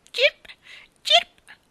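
very high, loud chirping like a small bird; little bird answering with a high chirp; dejected bird chirp. Acted. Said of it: "rispose l'uccellino alzando le ali con aria scorata".

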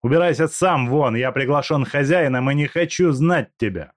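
angry retort. Acted. Степанов отвечает в ссоре.

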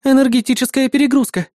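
high, urgent female AI warning; urgent exclamation. A narrator's reading. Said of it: "воскликнула Эрис".

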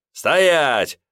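громкий высокий окрик; рявкнуть приказ; крик. A narrator's reading. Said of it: "заорал Леонтий". (Леонтий орёт на карманника)

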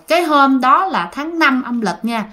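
ordinary delivery

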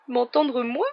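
high-pitched, indignant squeak. A narrator's reading. Ron hushed by Harry, in a shrill frightened voice. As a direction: let the voice shoot up in alarm. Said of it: "d'une voix plus aiguë qu'à l'ordinaire".

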